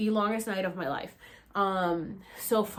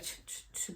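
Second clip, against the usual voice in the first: soft unvoiced ch ch ch rattle; maraca shaker sound; 15 dB quieter than usual. Describing the shaman's plant, soft unvoiced maraca rattle. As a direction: shake it like maracas with your mouth. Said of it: "sounds like, um, maracas".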